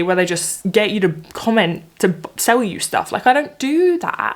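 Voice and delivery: ordinary talk, nothing marked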